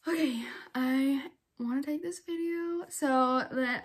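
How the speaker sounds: hushed, slow whisper so no one hears. Real, not acted. Filming herself in secret, whispering. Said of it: "unvoiced whisper".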